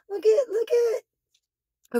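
high, slow, squeaky gush; lost for words at the cuteness; overwhelmed by cuteness. Real, not acted. Speechless over cute duck.

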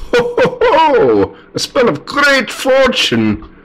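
very high, slow wizard chortle; triumphant wizard. Acted. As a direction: announce the spell grandly, high and drawn out. Wizard casts a spell.

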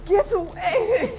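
slow, high, loud cry to get away; desperate scream. Acted. Wife screaming at her attacking husband, desperate. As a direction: scream him off you.

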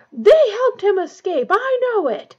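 high, very loud howl; furious howl. A narrator's reading. Snape accusing the children before everyone, howling. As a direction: howl the accusation at full pitch. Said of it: "Snape howled".

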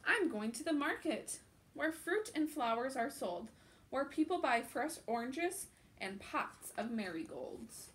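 put-on animated children's book narration; picture book read-aloud. A narrator's reading. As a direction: read it out like storytime for little ones.

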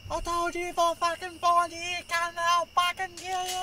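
high, far-off furious threat; menacing yell. Acted. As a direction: roar it like a movie villain. Villain threatens Norton.

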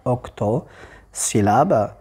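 slow, stretched-out word; deliberate, drawn-out dictation of the term; broken into syllables. Shown as a demonstration. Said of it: "Donc c'est encore un octosyllabe".

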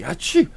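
loud, high fake "achoo"; acted allergy sneeze. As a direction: burst out with a pretend sneeze. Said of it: "my allergies is so bad".

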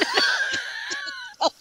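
high-pitched laugh, trailing down; real high giggling laugh; laughter. Real, not acted. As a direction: burst into high, soft laughter. Laughing at the co-host's banter.

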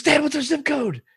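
put-on high, loud, frantic kid calling out; imitating a flustered daughter yelling for help; panicked child. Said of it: "I know that panic".

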